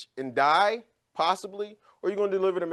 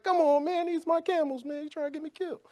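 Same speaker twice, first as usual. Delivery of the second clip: much higher, pleading comic voice; whiny Obadiah; 11 semitones higher than usual. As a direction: complain like a scared sidekick dragged along. Obadiah protesting like the sidekick.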